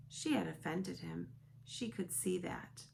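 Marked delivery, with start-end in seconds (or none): none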